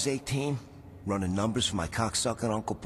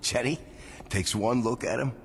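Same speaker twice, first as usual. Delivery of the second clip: usual speaking voice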